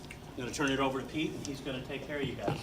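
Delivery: ordinary delivery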